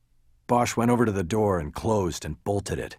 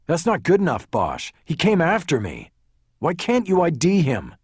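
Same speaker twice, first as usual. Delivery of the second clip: louder, higher, indignant protest; angry challenge; 8 semitones higher, 5 dB louder than usual. A narrator's reading. Lawyer challenges detective over his attacker, indignant. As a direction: push back hard at the detective.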